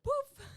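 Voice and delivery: high loud puff noise; poof sound. Pretend. Friend mimics frizzy hair going poof. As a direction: blurt a poof like hair exploding.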